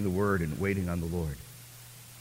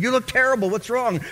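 alarmed dad; 13 semitones higher, 5 dB louder than usual. Retold or imitated.

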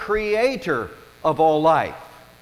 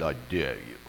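much lower, softer, unbothered big dog; Great Dane voice; 9 dB quieter than usual. Retold or imitated. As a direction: drop way down like a huge calm dog. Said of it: "the Great Dane just looked at him and said".

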